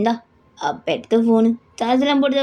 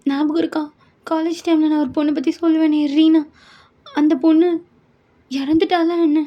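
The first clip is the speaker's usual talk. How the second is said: put-on higher, girlish pleading daughter voice; daughter Yoon; 7 semitones higher, 3 dB louder than usual. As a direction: play the worried young daughter on the phone. Daughter asks about Reena. (உயர்ந்த குரலில் கவலையான மகள்)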